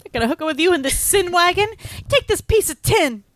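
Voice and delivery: high, loud melodramatic outrage; mock indignant outburst. Acting out the girl storming out of the car, hammed-up indignation. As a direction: fling it out like an offended movie heroine. Acts out Sandy's angry exit line.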